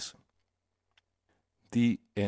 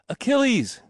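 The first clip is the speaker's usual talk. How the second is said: very high excited cry; cried out; 13 semitones higher, 3 dB louder than usual. A narrator's reading. Boy hailing the warrior, cried out. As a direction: call the hero's name, pitch leaping up. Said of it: "he cried".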